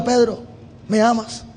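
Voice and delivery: soft, quiet, gentle asking; Jesus softly asking Peter if he loves him, tender. Pretend. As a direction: ask him tenderly, almost hushed. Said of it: "Jesús con amor y paciencia".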